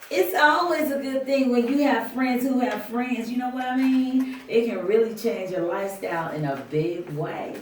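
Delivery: bragging about connections; loud high character voice. Acted.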